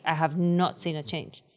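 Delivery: normal talking voice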